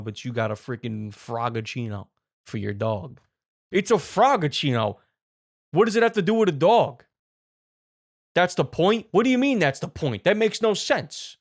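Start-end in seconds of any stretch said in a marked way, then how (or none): 3.7-11.4 higher-pitched, exasperated comic customer; angry Italian man. Acted. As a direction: argue in baffled, hammed-up outrage. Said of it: "Angry Italian wants a cappuccino".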